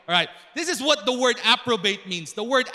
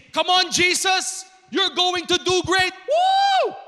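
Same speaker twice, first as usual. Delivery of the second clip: loud, high-pitched hyped cheer ending in a whoop; mock cheering; 7 semitones higher, 5 dB louder than usual. Preacher imagines God cheering Jesus on, mock cheering. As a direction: cheer like an over-excited sideline coach.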